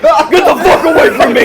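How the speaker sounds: high, loud laughing shout. Pretend. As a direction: yell it while laughing.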